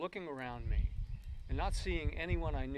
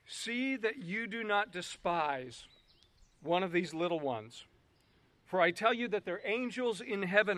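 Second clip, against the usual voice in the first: solemn Bible reading building louder; scripture reading; 3 semitones higher, 8 dB louder than usual. Acted. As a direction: read the passage with rising fervor. Reads scripture aloud.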